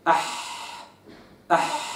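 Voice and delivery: أح with a soft breathy ح from the mid throat; breathy ah sound. Shown as a demonstration. Teacher showing the sound of ح to a student, soft breathy أح. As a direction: breathe out the ح in أح, quietly. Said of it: "فأقول".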